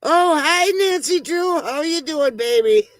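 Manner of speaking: high-pitched gushing hello; high, cooing welcome; delighted greeting. Real, not acted. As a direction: greet a newly arrived friend in a high, sweet voice. Greeting a viewer who arrives.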